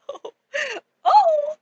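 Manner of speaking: high squeaky startled oh oh. Retold or imitated. To the stranger who knew her subscribers, a startled squeak. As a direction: yelp it out in shock. Reacting to creepy stranger in game.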